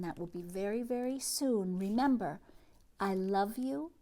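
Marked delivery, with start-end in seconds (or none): none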